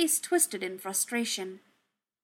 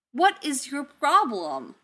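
exasperated; 5 semitones higher, 6 dB louder, slower than usual. Acted. Tony exasperated at Dummy.

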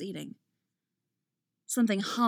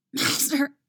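higher, louder choked cry; gasped out; 6 semitones higher, 4 dB louder than usual. A narrator's reading. Anakin being choked calls to his master, gasping. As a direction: force the word out while choking. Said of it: "he gasps out".

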